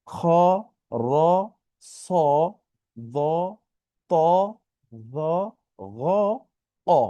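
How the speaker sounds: "খ", "র", "স" onward as isolated rounded o syllables; correct letter sounds. Shown as a demonstration. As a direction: sound each one from "খ" to "অ" alone, long pauses between. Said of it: "হবে কিরকম?".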